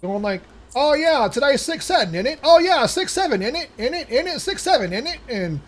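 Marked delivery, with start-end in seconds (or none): 0.8-5.2 mock British accent, high and loud; terrible British accent. Shoppers in line chanting six seven in a bad British accent. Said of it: "these terrible British accents".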